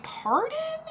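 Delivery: very high-pitched baffled question; incredulous. Retold or imitated. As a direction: pitch leaps up in exaggerated disbelief. Retold baffled reply to date.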